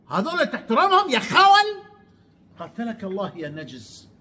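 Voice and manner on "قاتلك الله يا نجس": muttered curse. Real, not acted. Vlogger cursing Amr under his breath, muttered. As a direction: hiss the insult half to himself.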